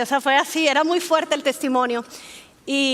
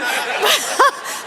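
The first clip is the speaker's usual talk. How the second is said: bright burst of laughter; 7 semitones higher, 5 dB louder than usual. Real, not acted. She laughs at her own reply to God. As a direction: laugh at yourself.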